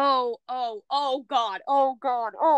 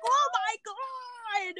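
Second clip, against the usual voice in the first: horrified gasp; 11 semitones higher, 5 dB quieter, much slower than usual. Retold or imitated. Horrified at sex scene.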